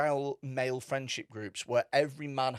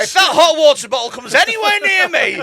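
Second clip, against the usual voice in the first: high-pitched shouted threat; mock furious; 14 semitones higher, 15 dB louder than usual. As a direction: yell it like an absurd ultimatum.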